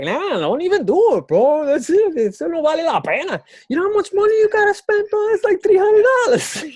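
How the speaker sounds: very high, loud mock-dad voice; high, loud, fast-talking impression of dad objecting; imitated father. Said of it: "that's something that my father always says".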